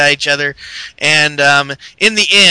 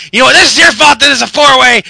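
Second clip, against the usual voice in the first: shouted blame, much louder and higher; angry yell; 8 semitones higher, 7 dB louder, faster than usual. Retold or imitated. Seth blaming Kane, shouting angrily. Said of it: "he's like".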